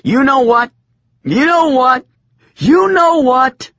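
high shrill rising yell; furious yelling. A narrator's reading. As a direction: build the fury each time. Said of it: "I was furious".

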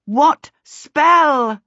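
high, loud, stretched-out demand; bellowed question, each word held; bawled. A narrator's reading. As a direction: bawl it at someone who isn't answering. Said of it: "Charmaine bawled at him".

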